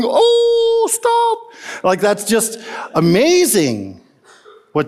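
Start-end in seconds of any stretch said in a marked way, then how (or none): 0.0-1.8 squealing high mock gasp of disbelief; amazed onlookers. Crowd watching Jesus snub Simon, gasping high mock disbelief. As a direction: play the stunned dinner guests, way up high. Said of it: "They're going".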